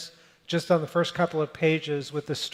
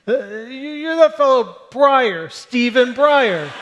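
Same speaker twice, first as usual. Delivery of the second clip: higher, louder stranger, slow on the name; triumphant stranger; 8 semitones higher, 8 dB louder than usual. Retold or imitated. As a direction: proudly get the name wrong, slowly. Stranger naming the wrong justice.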